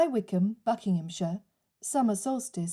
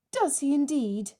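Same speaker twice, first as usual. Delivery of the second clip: sharp, raised, indignant retort; temper flaring; 6 semitones higher than usual. Acted. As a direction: bite back with sudden anger. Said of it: "her temper flared at last".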